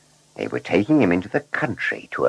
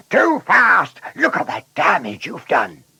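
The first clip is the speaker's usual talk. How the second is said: high-pitched angry scolding shout; 11 semitones higher, 3 dB louder than usual. A narrator's reading. Shepherd and bus driver blaming Blunderbuss for damage, shouting. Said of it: "they both shouted together".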